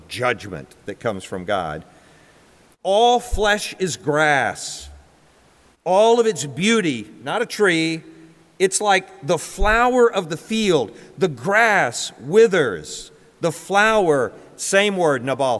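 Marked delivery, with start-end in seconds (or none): 2.8-7.1 slow, high, ringing declaration; scripture proclamation. Acted. Proclaims quoted scripture from pulpit. 8.6-14.3 slow, high, ringing declaration; scripture proclamation. Acted. Continues proclaiming the quoted verse.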